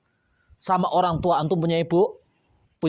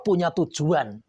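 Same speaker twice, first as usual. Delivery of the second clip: ordinary talk, nothing marked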